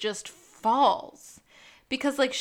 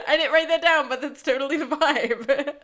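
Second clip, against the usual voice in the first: loud talk through laughter; laughing agreement; 4 semitones higher, 7 dB louder than usual. Real, not acted. Co-host agreeing it's the vibe, laughing and excited. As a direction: keep laughing as you say it, high and loud.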